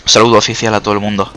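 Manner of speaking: usual speaking voice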